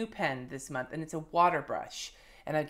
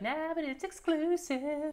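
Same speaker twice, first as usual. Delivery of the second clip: much higher, slow, teasing put-on voice; a bratty little voice in her head gloating; smug inner voice; 12 semitones higher, slower than usual. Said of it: "part of me is like".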